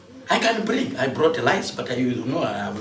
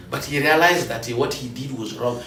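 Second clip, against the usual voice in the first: ordinary delivery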